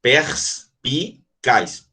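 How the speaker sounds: "Perspicaz" very slowly, a long pause between pers, pi and caz; syllable by syllable. Shown as a demonstration. Teacher showing how the word splits, "Perspicaz" in three separated syllables. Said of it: "é assim, ó".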